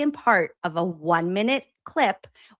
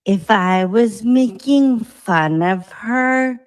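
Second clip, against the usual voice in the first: slow, loud, drawn-out dramatic warning; dramatic defiance; 7 dB louder, much slower than usual. Real, not acted. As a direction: lay it on thick, every word weighed.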